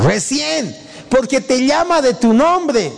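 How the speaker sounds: high-pitched exclamation; sudden realization. Acted. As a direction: burst out as if it just dawned. Exclaims at the moment of recognition.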